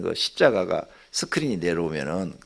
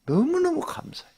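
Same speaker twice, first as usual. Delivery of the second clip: 너무너무 thrown up much higher in an excited burst; joyful thanks. Real, not acted. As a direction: sing out 너무너무 with delight. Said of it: "진짜 화가 안 나".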